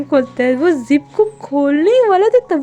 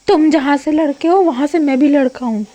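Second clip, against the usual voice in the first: indignant comeback; 3 semitones higher, 6 dB louder than usual. Acted.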